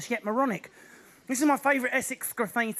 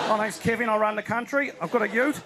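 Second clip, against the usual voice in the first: relaxed mock Australian accent; lightly hammed-up Australian bloke; Australian impression. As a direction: put on an easygoing Aussie accent. Mimics relaxed Australian leader.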